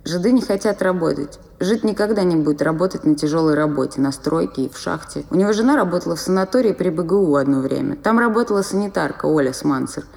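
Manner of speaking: usual speaking voice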